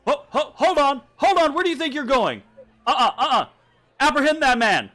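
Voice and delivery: shouted high and loud; loud, high, urgent order to stop; guard's alarmed challenge. Acted. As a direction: bellow like a guard halting a fugitive. Guard challenges travellers, calling out.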